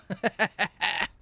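high cackle; gleeful laugh. Real, not acted. Player watching somebody fall, gleeful high cackle. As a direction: cackle high at their bad luck.

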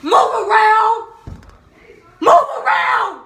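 high, slow, loud furious yell; angry shout. Shown as a demonstration. Vlogger acts out yelling at someone making her mad, loud. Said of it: "making you mad".

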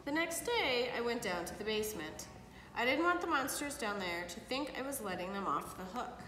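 put-on lively read-aloud for kids; storybook reading. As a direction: read it like bedtime story to children. Parent reads picture book aloud.

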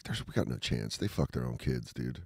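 low, hushed hopeless mutter; mock defeated soldier. Soldier facing Spartans, mock hopeless low mutter. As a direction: mumble it like a soldier who knows he's doomed. Voicing doomed Spartan opponents.

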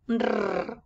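"R" as a long trilled rrr; strong R sound. Shown as a demonstration. Teacher showing the strong sound, long rolled R. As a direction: roll a long "R" on its own. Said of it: "el sonido fuerte".

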